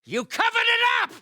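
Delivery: high, enraged villain shout; furious accusation. Acted. Villain accuses his enemy.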